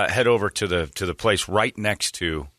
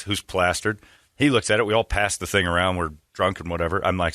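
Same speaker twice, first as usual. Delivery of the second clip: usual speaking voice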